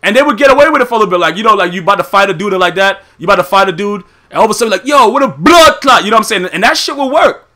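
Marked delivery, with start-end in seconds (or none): 4.8-6.0 hammed-up Jamaican yard accent, slow and loud; mock a Jamaican tough guy, drawn out; over-the-top Jamaican patois shout; exaggerated Jamaican. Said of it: "extra Jamaican with cheese".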